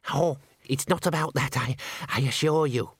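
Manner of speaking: hesitant, mildly anxious protest; a touch of flustered politeness; flustered denial. A narrator's reading. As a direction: stammer out an assurance. Henry flustered, denies it.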